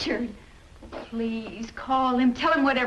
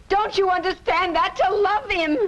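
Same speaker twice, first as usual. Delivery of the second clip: desperate plea; 7 semitones higher, 3 dB louder than usual. Acted. Wife pleading desperately with husband.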